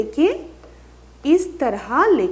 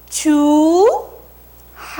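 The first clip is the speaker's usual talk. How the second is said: slow, loud classroom model of चूहा; drawn-out model word; 5 semitones higher, 5 dB louder, much slower than usual. Shown as a demonstration. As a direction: stretch चूहा very slowly, higher and louder. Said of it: "अब बोलिए मेरे साथ".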